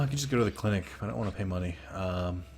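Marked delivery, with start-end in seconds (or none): none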